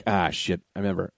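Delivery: low, soft aside to himself; retold sinking realization. Customer spots the clerk again, low retold dismay. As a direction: drop it down under your voice.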